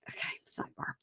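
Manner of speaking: breathy whisper, sidebar; whispered aside. Real, not acted. To her co-host, a whispered aside.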